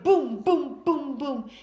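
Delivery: loud booming thuds, falling down a hill; tumbling booms. Shown as a demonstration. Storyteller booming as the girl tumbles down. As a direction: punch each boom like a body rolling.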